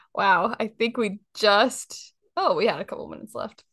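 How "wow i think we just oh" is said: ordinary talk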